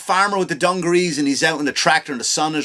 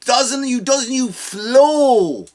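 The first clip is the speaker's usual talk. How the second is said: drawn-out, higher mock pride on flow; mock patriotic swell; 5 semitones higher, slower than usual. Host mocking the rally with hammed-up patriotic pride.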